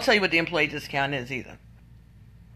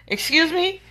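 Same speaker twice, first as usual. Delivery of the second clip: indignant; 10 semitones higher than usual. Real, not acted. Indignant at the dealer.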